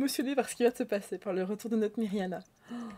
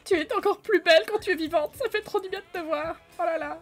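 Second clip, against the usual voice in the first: tender, doting; 8 semitones higher than usual. Acted. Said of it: "la rendre enjôleuse".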